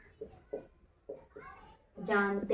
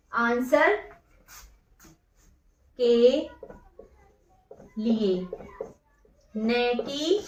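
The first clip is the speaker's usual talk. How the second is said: word by word, quieter, long pauses between words; slow dictation; 3 semitones lower, 6 dB quieter, slower than usual. Shown as a demonstration. Teacher dictating exam notes to students, slow word-by-word dictation. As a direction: dictate each word slowly so students can write.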